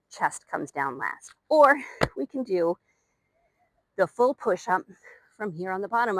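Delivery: panting between cues; winded and pushing through; out of breath. Real, not acted. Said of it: "I'm really out of breath".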